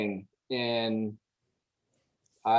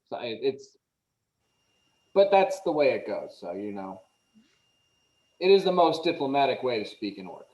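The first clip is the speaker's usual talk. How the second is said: normal talking voice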